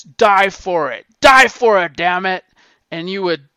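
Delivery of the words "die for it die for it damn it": loud, building shout; coach yelling. Retold or imitated. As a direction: drive the players to dive. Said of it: "he says".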